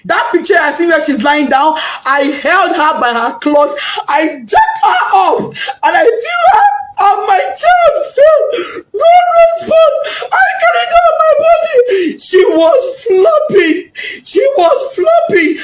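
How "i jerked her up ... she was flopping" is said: crying while telling. Real, not acted. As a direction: cry it out, voice breaking high. Mother recounting finding dead daughter.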